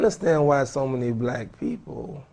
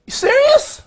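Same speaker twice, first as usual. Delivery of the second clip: much higher, stunned question; genuinely taken aback; surprised; 6 dB louder than usual. Real, not acted. Reacts to audience answer.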